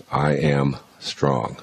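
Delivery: slow, deliberate scripture line; slow affirmation. Shown as a demonstration. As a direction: say it slowly and firmly. Scripture quote said slowly.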